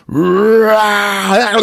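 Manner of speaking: loud high hammed-up roar; mock monster roar. Ghost screaming at the woman, mock roar. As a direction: roar at her. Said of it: "you hear him scream".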